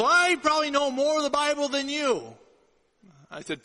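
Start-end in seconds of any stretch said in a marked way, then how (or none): 0.0-2.3 put-on smug drunk. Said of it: "And he goes".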